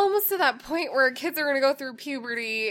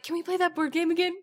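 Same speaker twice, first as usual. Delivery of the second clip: put-on eager child; 6 semitones higher, faster than usual. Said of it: "He woke up and he's like".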